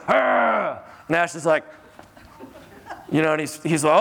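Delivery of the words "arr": sudden high dog snarl, imitated; imitated little-dog snarl; dog growl. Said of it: "this little dog goes".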